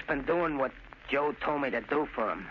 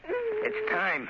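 anguished outcry. Acted. Son as brother's execution hour arrives, anguished cry. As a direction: voice leaping very high.

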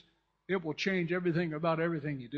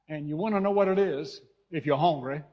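Oklahoma dialect; 7 dB louder than usual. Real, not acted. Preacher slipping into dialect while preaching on hunger, Oklahoma accent. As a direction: lean into a country Oklahoma accent. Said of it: "Sorry, I'm going back to my Oklahoma dialect".

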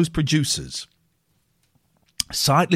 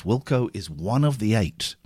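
normal talking voice

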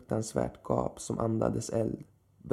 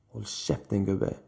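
usual speaking voice

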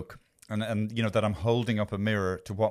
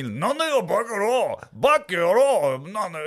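high, loud mock-screamed Japanese, stretched out; screaming Yakuza; 8 semitones higher, 5 dB louder, slower than usual. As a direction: scream it at the street performer like a thug. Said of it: "screaming at me".

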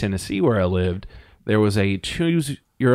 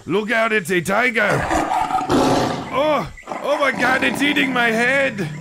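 mock panic; 14 semitones higher than usual. Acted tiger attack.

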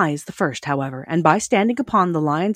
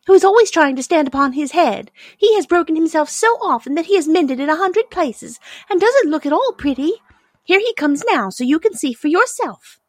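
high little princess voice, louder; dainty china princess; 11 semitones higher, 5 dB louder than usual. A narrator's reading. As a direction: explain primly as a fragile china lady.

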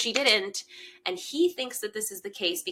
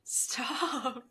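ordinary talk, nothing marked

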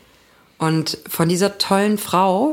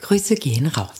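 whisper; 3 semitones lower than usual. Real, not acted. Shout-out in a whisper.

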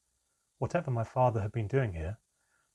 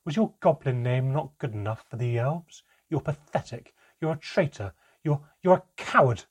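angry insults climbing in pitch and volume; escalating contempt, louder on each jab; rising with every insult; 5 semitones higher, 7 dB louder than usual. A narrator's reading. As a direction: build from scornful question to loud, high final insult. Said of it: "Her voice rose with every insult".

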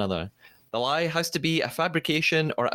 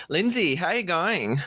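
higher-pitched mock Aussie greeting; terrible Australian accent; 6 semitones higher than usual. Host greeting guest co-host in a hammed-up Australian accent. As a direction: put on a bad Australian accent. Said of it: "speak in terrible Australian accents".

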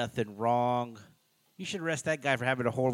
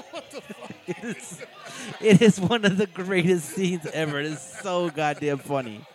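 words pushed through laughter, higher; laughing while talking; 6 semitones higher, 6 dB louder than usual. Real, not acted. Recapping the funny movie scene, still laughing. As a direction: keep laughing as you speak.

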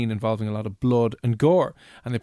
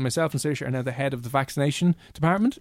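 ordinary delivery

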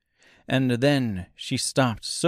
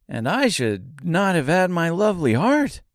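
high, tender tin man; a fond, wistful metal man; Tin Woodman voice; 6 semitones higher, 4 dB louder than usual. A narrator's reading.

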